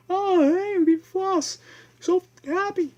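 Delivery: very high, loud mock-excited kid voice; floss-loving kid squealing high with fake glee; squeaky mocking kid. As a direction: squeal it like an overjoyed kid who got floss. Mocking hygienic kid's voice.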